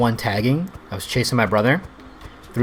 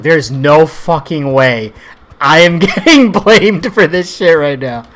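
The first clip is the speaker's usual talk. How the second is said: louder, slow outraged protest breaking into a laugh; indignant protest; 5 semitones higher, 9 dB louder, slower than usual. Retold or imitated. Son protesting to his parents about being blamed, indignant laughing protest. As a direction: protest hard like a kid refusing the blame. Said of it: "I was like".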